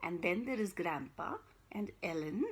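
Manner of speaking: ordinary delivery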